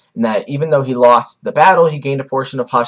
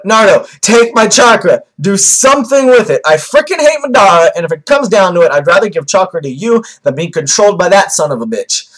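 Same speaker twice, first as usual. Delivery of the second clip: loud, high, urgent creature rant; hammed-up desperate shouting, easing slightly by the end; fox in panic mode; 7 semitones higher, 6 dB louder than usual. Vlogger playing the fox begging Naruto to take chakra, loud and frantic. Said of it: "back to the fox's "oh shit" mode".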